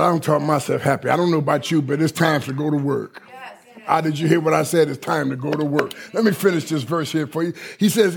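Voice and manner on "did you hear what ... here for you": normal talking voice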